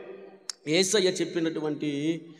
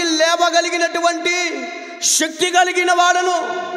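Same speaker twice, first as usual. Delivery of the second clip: loud, high victorious declaration; preacher's triumphant shout of resurrection; triumphant proclamation; 8 semitones higher, 6 dB louder than usual. Acted. Jesus proclaiming resurrection, climax.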